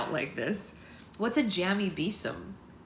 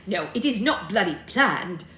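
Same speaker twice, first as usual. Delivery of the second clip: shouting; 7 dB louder than usual. A narrator's reading. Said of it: "shouted Sarah".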